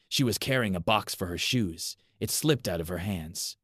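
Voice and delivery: usual speaking voice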